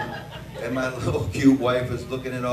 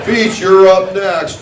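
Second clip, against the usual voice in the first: loud and higher, slow call; called up to jump; 6 semitones higher, 11 dB louder, slower than usual. Pretend. An official calling him up to the jump, loud. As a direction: shout the call, then answer back. Imagined call to ski jumper.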